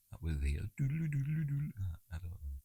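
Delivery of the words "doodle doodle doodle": high sung doodle doodle; vocal steel drum riff; steel drum imitation. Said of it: "Is that a steel drum?".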